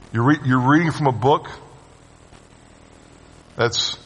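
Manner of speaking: incredulous, scornful questions; mocking scoffer. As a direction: sneer at the old book. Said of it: "The scoffer is the one who's going".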